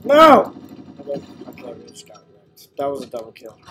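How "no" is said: loud high cry; anguished no. Real, not acted. Player killed cries out loudly. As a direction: shout no at the loss.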